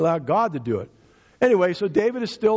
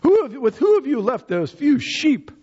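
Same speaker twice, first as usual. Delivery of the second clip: louder, higher scornful rebuke; mock outrage at the kid brother, voice raised; angry brother; 7 semitones higher, 3 dB louder than usual. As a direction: snap at him like a jealous older brother. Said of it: "he's angry at David".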